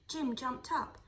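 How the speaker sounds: plain reading voice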